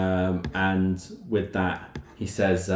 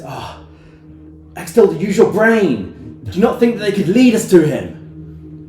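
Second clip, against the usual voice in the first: much higher, loud condescending voice; scolding NPC; 12 semitones higher, 11 dB louder than usual. Acted. Lord scolding Hextilda, high and loud. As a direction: talk down to her, annoyed.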